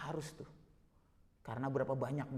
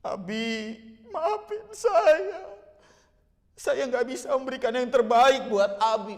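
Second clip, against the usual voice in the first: high, loud crying plea; tearful apology; 10 semitones higher, 12 dB louder, slower than usual. Acted. Child begging dying father's forgiveness, acted sobbing. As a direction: draw it out through sobs, voice climbing. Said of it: "Waduh, rasanya itu".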